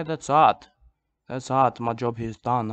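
ordinary delivery

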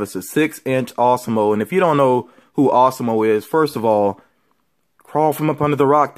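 ordinary delivery

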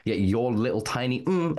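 normal talking voice

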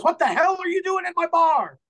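loud, high outraged shout; angry bar owner. Acted. Bar owner to intruders in his bar, angry high shout. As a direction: bellow it at trespassers, voice way up.

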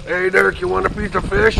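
a hint of Steve's way of talking; easygoing buddy offering fish, lightly imitated; imitating fisherman buddy. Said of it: "he talks like this".

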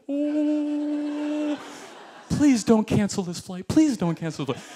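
high drawn-out begging plea; mock plea. Praying aloud the airline won't cancel, high slow begging. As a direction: plead with the sky, slowly.

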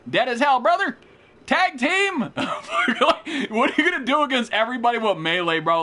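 high, loud victory crowing with a laugh; loud, laughing trash talk; gloating yell. Real, not acted. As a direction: crow over a beaten opponent. Gloating over a won fight.

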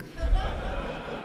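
short amused laugh. Real, not acted. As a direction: laugh at your own joke. Laugh at own joke.

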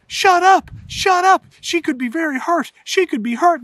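high, loud urgent scolding; hushing shout. Retold or imitated. Dad scolding laughing sons in a high urgent shout. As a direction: shut the kids up fast. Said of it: "Dad's like".

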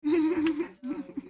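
high, far-off laughing; laughter. Real, not acted. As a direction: laugh at your own joke. Laughs after the Tyson joke.

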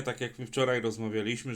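usual speaking voice

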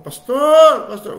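loud, high shout of "Pastor"; calling out. Retold or imitated. Child calling parent "Pastor", loud and high. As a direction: call across the room.

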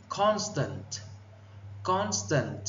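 "Constant" as CON-stant, high then falling; first-syllable stress. Shown as a demonstration. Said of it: "Nhấn âm, âm một". (nhấn âm một của "Constant")

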